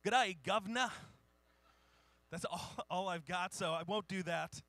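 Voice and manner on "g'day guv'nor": loud, exaggerated Cockney greeting; hammed-up Cockney accent; dirty Cockney. Shown as a demonstration. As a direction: belt out a rough Cockney hello. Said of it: "I do really dirty Cockney".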